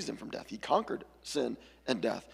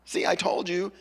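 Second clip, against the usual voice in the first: sing-song gloating, much higher; Bluey taunting her sister, higher and gloating; 7 semitones higher, 4 dB louder than usual. Retold or imitated. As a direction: tease like a smug little sister. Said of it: "Bluey's like".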